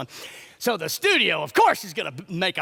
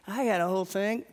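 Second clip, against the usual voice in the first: normal talking voice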